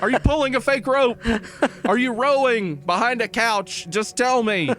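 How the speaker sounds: high-pitched pleading questions; flustered caller. Acted. Caller begging a mime for an answer, exasperated.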